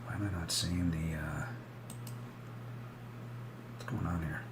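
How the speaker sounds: soft, half-whispered puzzling over the chart; quiet confused muttering to self; muttering to himself. Real, not acted. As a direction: mutter it under your breath, confused. Puzzled, checking his chart.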